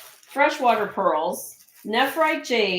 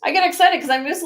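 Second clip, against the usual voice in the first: higher-pitched burst of real excitement; excited; 7 semitones higher, 4 dB louder, faster than usual. Real, not acted. Seller explaining to viewers why she was yelling, excited. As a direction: get excited defending your enthusiasm. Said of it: "I get excited about this kind of thing".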